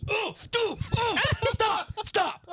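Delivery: freaked out. Real, not acted. Bug photo freaks her out.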